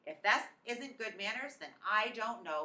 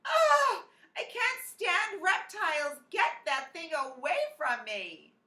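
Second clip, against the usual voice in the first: much higher, loud horrified shriek, slow at first; 9 semitones higher, 9 dB louder, slower than usual. A narrator's reading. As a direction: shriek at the reptile in disgust. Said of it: "Missus Yarby shrieked".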